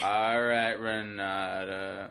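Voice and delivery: slow, drawn-out half-hearted praise; weary mock congratulations, dragging each word; imitating humouring parents. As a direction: humour the kid without enthusiasm.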